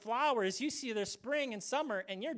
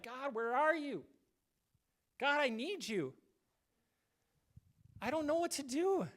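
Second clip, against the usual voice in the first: crying out to God; 5 semitones higher than usual. Pretend. Crying out in the Psalms.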